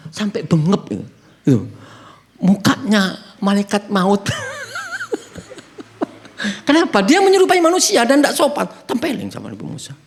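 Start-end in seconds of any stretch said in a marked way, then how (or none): 4.3-6.0 high-pitched burst of laughter. Real, not acted. Preacher laughs at story. (tawa melengking)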